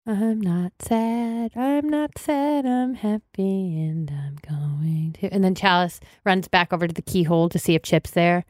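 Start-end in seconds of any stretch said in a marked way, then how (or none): 0.1-3.8 slow self-soothing. Acted. Bride talking herself calm. 3.8-5.3 soft slow whisper; barely breathed, slowing; whispered trailing off. Acted. Bride trailing off alone.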